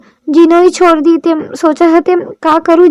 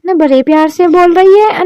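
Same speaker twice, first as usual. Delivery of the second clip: usual speaking voice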